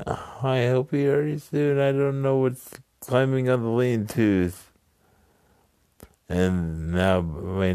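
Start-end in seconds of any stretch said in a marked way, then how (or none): 0.1-4.7 higher-pitched voice of a girl playing worried; imitated worried girl. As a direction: voice her fretting, pitched up. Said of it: "She's like".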